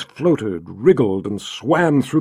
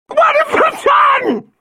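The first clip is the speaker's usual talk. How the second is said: high drawn-out shriek, slow; hysterical scream; 4 dB louder, slower than usual. Acted. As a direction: shriek the words in terror. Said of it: "Violet screamed again".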